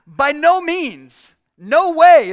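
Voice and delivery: a preacher crying out the apostle's emphatic denial; emphatic exclamation. Shown as a demonstration. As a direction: proclaim it like a shocked refusal. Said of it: "It's like an exclamation".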